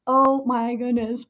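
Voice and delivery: slow, drawn-out exclamation; retold slow amazement; dawning dismay. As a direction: stretch it out as it sinks in. Reacting to colleague's advice, retold.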